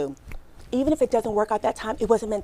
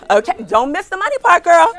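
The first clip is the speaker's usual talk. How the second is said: playful call-out; 9 semitones higher, 9 dB louder than usual. Real, not acted. Calling out to an audience member.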